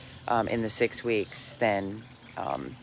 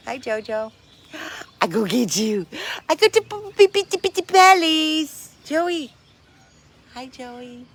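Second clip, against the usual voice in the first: talking to a dog; 13 semitones higher, 6 dB louder than usual. Real, not acted. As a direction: coo at the little dog in a high voice. Greeting the dog.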